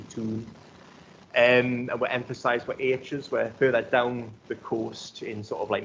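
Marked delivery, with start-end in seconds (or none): 0.0-0.5 "toon" said softly with a long oo instead of ow; Geordie toon. Shown as a demonstration. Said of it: "town would be toon".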